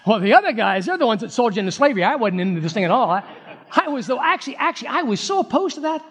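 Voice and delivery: fast start, climbing into high-pitched protest; excuse-making brother. Acted. Preacher playing a brother disowning the betrayal to Joseph, high hurried excuses. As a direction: plead innocence in a hammed-up, rising voice. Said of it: "here's what I probably would have said".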